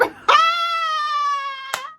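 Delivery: shrill, high, cry-like cackle; high shrieking laugh. Real, not acted. Mocking her own pretence of not knowing him, with a high shrieking laugh.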